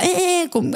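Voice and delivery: high squeaky eh eh like a dolphin; dolphin noises. Retold or imitated. Mother imitating her daughter's dolphin sounds, high squeak. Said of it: "conductas de delfín".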